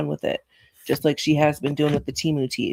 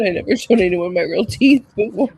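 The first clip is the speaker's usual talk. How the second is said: mock shy reveal; 4 semitones higher, 6 dB louder than usual. Mocking a woman's reveal.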